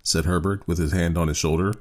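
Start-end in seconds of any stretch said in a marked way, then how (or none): none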